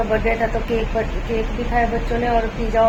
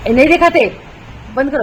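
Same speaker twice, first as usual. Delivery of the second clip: sharp scold; 4 semitones higher, 6 dB louder than usual. Real, not acted. Scolds child showing torn pants.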